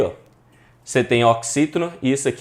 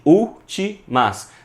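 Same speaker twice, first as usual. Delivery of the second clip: "últimas" slowly broken as úl-ti-mas; split into syllables; 6 semitones higher, 5 dB louder, much slower than usual. Shown as a demonstration. Teacher divides the word for students, saying "últimas" in separate syllables. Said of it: "que a gente divide".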